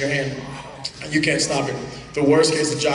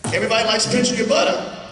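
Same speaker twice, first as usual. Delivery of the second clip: normal talking voice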